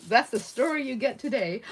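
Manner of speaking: usual speaking voice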